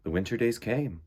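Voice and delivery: unhurried picture-book narration; storybook reading. Acted. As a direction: read it like story time for little ones. Reads picture book aloud.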